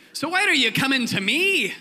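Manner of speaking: high, loud Scottish protest; John the Baptist, Scottish accent. Acted. John questioning Jesus coming to him, loud Scottish accent. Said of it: "sorry, I'll do Scottish".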